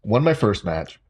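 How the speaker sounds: ordinary talk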